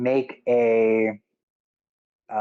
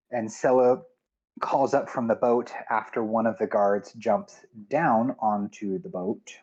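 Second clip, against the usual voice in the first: ordinary delivery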